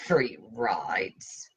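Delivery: whispered scornful echo; muttered echo. Acted. T whispers Mike's words back with contempt. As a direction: dismiss it under the breath.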